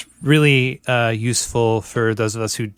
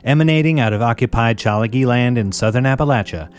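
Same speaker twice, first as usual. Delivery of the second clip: a slightly louder, bright announcer read over music; radio intro read; 3 semitones higher, 3 dB louder than usual. Acted. Radio host opening the weekly anarchist show for listeners, in an announcer read.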